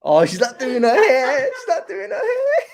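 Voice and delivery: high, helpless laughing voice; laughing squeal. Real, not acted. Streamer teases mum about her hair, squealing with laughter. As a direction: climb into a squeal through real laughter.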